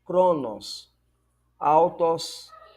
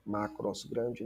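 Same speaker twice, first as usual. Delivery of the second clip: soft echo; 7 semitones lower, 11 dB quieter than usual. Shown as a demonstration. Teacher contrasting one word.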